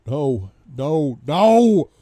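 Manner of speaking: building from loud to full high shout; anguished cry. A narrator's reading. Husband realises wife is going, anguished shouting. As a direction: cry out in escalating despair.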